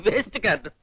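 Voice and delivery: soft words through a laugh. Acted. Player laughs back at the priest's bad card, soft.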